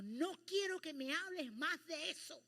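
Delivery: loud, firm rebuke; stern scolding. Retold or imitated. God firmly rebuking the preacher's request, loud scolding. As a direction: bring the hammer down as the scolding voice. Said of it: "voz fuerte de regaño".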